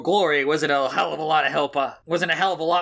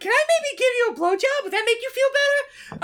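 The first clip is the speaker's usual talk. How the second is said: man doing a woman's voice. Acted. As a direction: squeak it like a ditzy bimbo. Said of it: "I'll do the girl voice for her".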